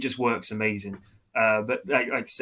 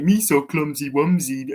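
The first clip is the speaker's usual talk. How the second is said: put-on lisping baby-talk creature voice, a bit louder; mock the goofy childish alien; silly babyish Jar Jar impression; 2 semitones higher, 5 dB louder, slower than usual. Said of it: "He talks like a baby".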